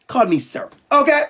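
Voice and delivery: normal talking voice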